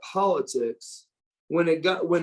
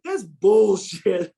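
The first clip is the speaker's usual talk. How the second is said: mock indignation; 5 semitones higher, 5 dB louder, much slower than usual. Ron's indignant reply quoted.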